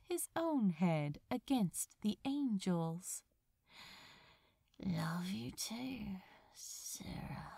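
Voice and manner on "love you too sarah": sleepy, breathy whisper of love; hissed whisper. A narrator's reading. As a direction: hiss it softly, half asleep. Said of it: "he'd hissed".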